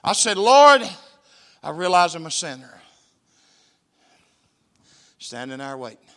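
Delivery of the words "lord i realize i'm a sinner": prayer called out. Shown as a demonstration. Preacher leading the prayer for him to repeat, loud. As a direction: lead the prayer line aloud. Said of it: "repeat it out loud".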